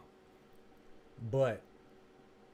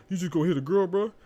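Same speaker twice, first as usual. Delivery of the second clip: louder, higher mock challenge; imitated confrontation; 6 semitones higher, 8 dB louder than usual. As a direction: play a bystander calling him out. Quoting passing dudes confronting the homeboy.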